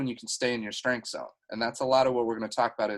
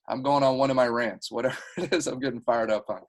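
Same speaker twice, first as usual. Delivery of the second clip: normal talking voice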